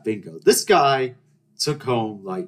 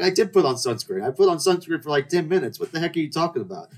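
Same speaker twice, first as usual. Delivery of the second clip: higher-pitched mock indignation; indignant protest; 7 semitones higher than usual. Sunburnt swimmer defends himself to companion, indignant protest. Acted protest at being scolded.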